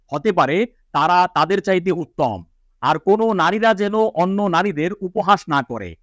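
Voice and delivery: normal talking voice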